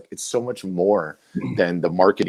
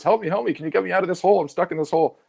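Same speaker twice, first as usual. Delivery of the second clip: much higher, pleading mock call; hammed-up plea from the bottom of a hole; mock cry for help; 12 semitones higher, faster than usual. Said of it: "And he goes".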